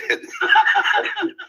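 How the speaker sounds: high-pitched burst of laughter; short high laugh. Real, not acted. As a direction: crack up at your own question. Laughs after his own retold question.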